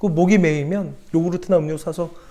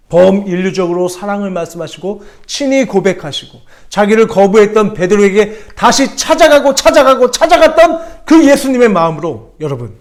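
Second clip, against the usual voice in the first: high, loud impassioned sermon; full-force preaching; 4 semitones higher, 8 dB louder than usual. Acted. Pastor urges the church to speak love, full-force preaching. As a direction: preach it to the back row.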